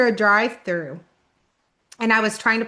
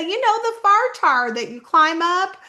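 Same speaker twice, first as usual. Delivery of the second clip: higher-pitched retold explanation in Southern accent; local Southerners; 7 semitones higher than usual. Locals explaining the fire tower to a newcomer, insistent Southern voice. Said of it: "And they would say".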